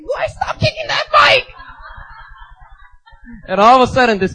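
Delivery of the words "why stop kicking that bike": high, slow mock wail; scolding sister. Sister scolds him in argument.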